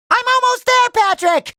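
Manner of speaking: high, straining rescue call; urgent calling out. Acted. Hero nearly reaches Patrick, urgent high-pitched call. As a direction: call ahead to a friend you are rushing to.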